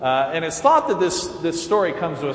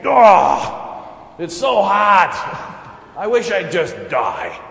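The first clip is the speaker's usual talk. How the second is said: a touch of a drawn-out, louder whine; lightly acted whiny sulk; 4 semitones higher, 7 dB louder, slower than usual. Jonah moaning to God about the heat, mildly hammed-up. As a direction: moan about the heat a bit.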